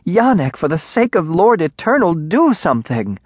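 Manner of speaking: high, exasperated mother's yell; urgent womanly outcry. A narrator's reading. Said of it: "heard his mother yell".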